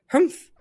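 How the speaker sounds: high, loud offended grunt; sharp indignant snort; indignant humph. Acted. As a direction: huff as your toupee flies off. Man loses toupee.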